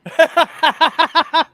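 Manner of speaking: loud, high cackling burst; big belly laugh. Real, not acted. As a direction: crack up hard in a rapid, high laugh. Cracks up at a joke.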